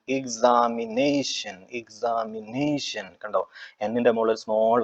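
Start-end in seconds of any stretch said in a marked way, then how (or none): 0.1-3.2 examination slowly, with നേ drawn out; stress on NE. Shown as a demonstration. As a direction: stretch examination out, leaning on the syllable നേ. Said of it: "എവിടെയാണ് stress ചെയ്യുന്നത്".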